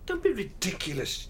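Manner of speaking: jolted disbelief; father stunned by daughter's news; shocked. Acted. Daughter announces moving out.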